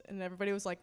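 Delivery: ordinary talk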